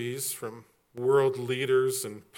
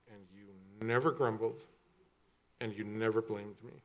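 softer, hushed praise to his wife; tender thanks; 4 semitones lower, 8 dB quieter than usual. Real, not acted. Husband softly thanking wife for moving with him. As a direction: speak gently to your partner.

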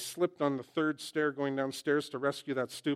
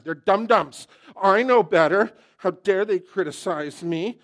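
much louder and higher, hammed-up outrage; mock indignant shout; 6 semitones higher, 13 dB louder than usual. Preacher playing his proud self, much louder mock indignation. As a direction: blow up like an offended genius. Acted rant peaks.

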